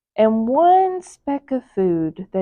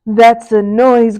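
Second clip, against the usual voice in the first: louder, slow savoring villain; grinning Grinch; 6 dB louder, slower than usual. Acted. The Grinch relishing the Whos' cries, louder and slow. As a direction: savor it like a treat. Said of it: "grinned the Grinch".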